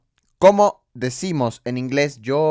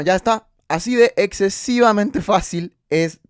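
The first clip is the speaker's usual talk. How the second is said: emphatic delight; 8 semitones higher, 5 dB louder than usual. Real, not acted. Teacher stresses how easy it is.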